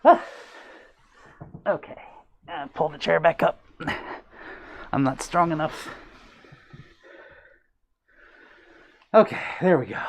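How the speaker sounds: panting after a dance; breathless, catching air between words; out of breath. Real, not acted. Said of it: "I'm a little out of breath from that".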